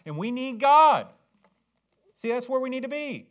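high, loud proclamation; fervent high, loud preaching; preaching climax. Real, not acted. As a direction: lift the last line to a peak. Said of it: "climax of sermon appeal".

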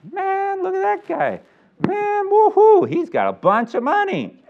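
high, amazed cheering bystander; excited onlooker. Retold or imitated. Bystander marveling at a rich giver, high and excited. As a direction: gawk at the rich man's gift, thrilled.

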